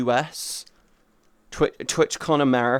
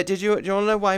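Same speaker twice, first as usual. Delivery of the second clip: ordinary talk, nothing marked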